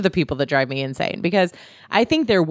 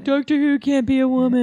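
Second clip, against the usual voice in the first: sneering mock quote; mock the whiny fan; 7 semitones higher than usual. Mocking sexist fans.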